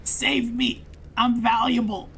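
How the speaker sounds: hammed-up wounded hero. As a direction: beg dramatically to be saved. Wounded soldier hams up pleas.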